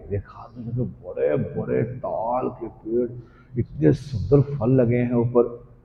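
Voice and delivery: slow, soft marvelling; hushed wonder. Acted. Boys gazing up at palm fruit, awed. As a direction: take in the sight slowly.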